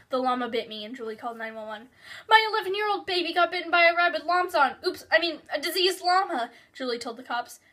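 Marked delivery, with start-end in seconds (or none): 2.3-6.5 frantic mother. Acted. Mother's emergency call to the cops.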